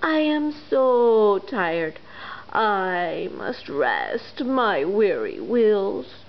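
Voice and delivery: slow, weary sighing engine; tired old engine. Acted. Rusty old engine turning the toys down, weary. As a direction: drag out every word with fatigue. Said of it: "the rusty old engine sighed".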